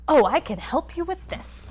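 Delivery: mock the counselor's eager helpfulness. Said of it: "his face just got so excited".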